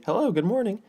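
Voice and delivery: high, bright-eyed mock greeting; cheery greeting. Runner greeting a stranger on a morning run, cheery.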